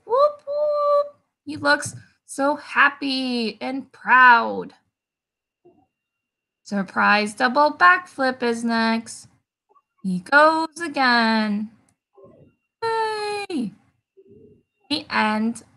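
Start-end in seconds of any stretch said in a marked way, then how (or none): 0.1-1.2 high, gleeful whoop whoop; cheering whoop. Acted. Jabari celebrating his jump. 12.8-13.7 cheering. Acted. Cheering the backflip.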